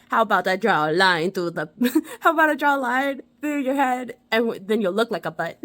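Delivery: put-on a lightly done Jamaican accent, laughing through it; bad Jamaican accent. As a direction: quote Sanka in a mock Jamaican accent, giggling. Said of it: "my Jamaican accent is terrible".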